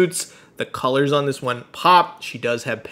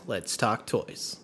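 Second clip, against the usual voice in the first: deep trailer voice; 5 semitones lower, 12 dB quieter, slower than usual. Acted. Trailer-style intro line.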